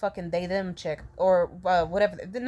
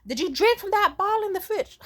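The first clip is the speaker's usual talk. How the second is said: suspicious mom; 12 semitones higher, 3 dB louder than usual. Retold or imitated. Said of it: "And she's like".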